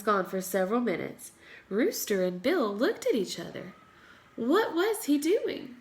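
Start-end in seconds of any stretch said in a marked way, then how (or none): none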